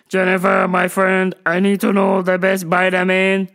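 comic put-on accent on a level monotone; stiff, evenly pitched caricature; Doctor Octopus. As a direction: imitate an oddball customer. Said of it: "I talk like this because I'm Doctor Octopus".

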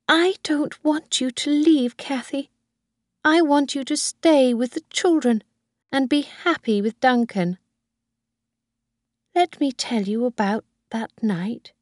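put-on a high old woman pleading with her son; old Mrs Harvey. Old woman voiced by reader.

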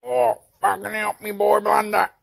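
high, loud desperate shout; panicked cry for help. Acted. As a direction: scream for your sidekick. Hero in danger calls out.

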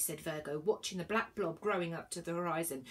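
ordinary delivery

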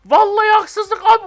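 high, slow, drawn-out whine; complaining the game cheated him; whiny protest. Real, not acted. Game speeds up, he loses.

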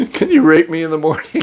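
quoting himself through laughter, pitch up; laughing quote. Retold or imitated. Retelling his blunder to host mother, laughing. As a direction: giggle out the mistaken request. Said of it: "She is very confused".

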